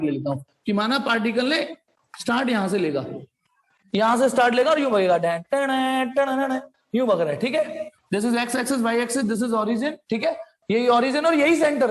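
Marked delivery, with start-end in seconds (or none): 5.2-6.6 drawn-out "dan-tan-tan" motion noise; imitated motion sound. Shown as a demonstration. Teacher tracing the particle's path for students, imitated motion sound. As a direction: sound out the movement in slow nonsense syllables.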